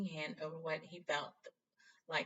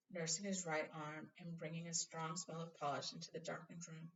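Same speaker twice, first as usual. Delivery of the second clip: usual speaking voice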